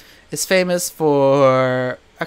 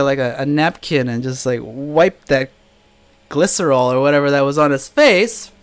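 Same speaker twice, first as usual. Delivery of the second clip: normal talking voice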